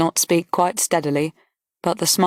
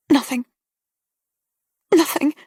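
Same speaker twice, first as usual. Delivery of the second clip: tearful; 8 semitones higher than usual. Acted. Distressed girl denies.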